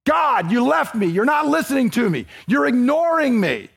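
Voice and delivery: raw complaint. Shown as a demonstration. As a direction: shout it at God like you mean it. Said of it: "Give it a shot".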